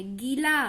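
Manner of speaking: "गीला" with a stretched ee; long vowel. Shown as a demonstration. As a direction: hold the first vowel of "गीला". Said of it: "ई देर तक बोला हमने".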